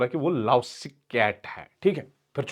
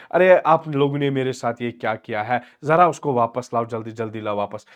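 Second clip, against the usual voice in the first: ordinary talk, nothing marked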